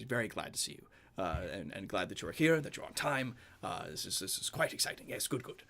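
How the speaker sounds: soft, hushed welcoming innkeeper voice; a quiet, fussy host voice; Benjamin's greeting. Acted. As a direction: welcome them in a soft near-whisper.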